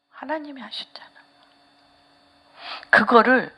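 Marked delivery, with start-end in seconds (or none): 0.1-1.1 fast, much softer whispered answer; quick whisper. Real, not acted. Hushed answer to own questions. (빠르게 속삭이며)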